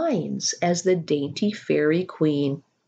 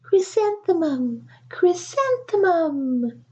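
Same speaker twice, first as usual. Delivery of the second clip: dreamy flower voices; 10 semitones higher than usual. A narrator's reading. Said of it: "the flowers seemed to say".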